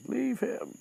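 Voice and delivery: high-pitched retold order to back off; quick mock command, voice jumping up high; acted command. Harry telling the snake to leave Justin alone, high-pitched command.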